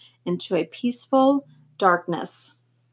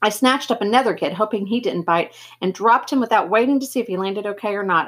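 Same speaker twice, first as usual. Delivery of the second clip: plain reading voice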